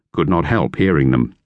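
ordinary delivery

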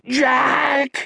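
high-pitched panicked cry; shrill-pitched character scream. A narrator's reading. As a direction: scream the name in alarm. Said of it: "he screamed".

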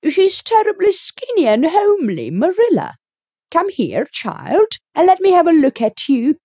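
put-on high, loud, tactless old gossip; a nosy neighbour inspecting a child; blunt busybody voice. A narrator's reading. Rachel voiced in story.

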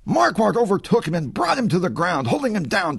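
ordinary delivery

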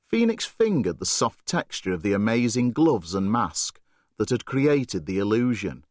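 ordinary delivery, nothing marked

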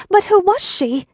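lightly voiced Dorothy; young girl asking. A narrator's reading. Dorothy asking the old woman, high and curious. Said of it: "asked Dorothy".

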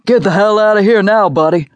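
loud, high urgent shout; yelled warning. Acted. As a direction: bark him away from the danger. Man yelling at car crash.

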